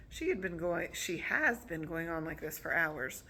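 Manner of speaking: plain reading voice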